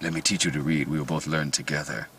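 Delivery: lightly voiced Spanish tutor in Nazi Germany, speaking gently; Spanish guy voice. Imitating the trailer's Spanish-accented tutor, soft and low. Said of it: "I don't know why I made him a Spanish guy".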